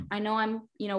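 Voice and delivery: ordinary talk, nothing marked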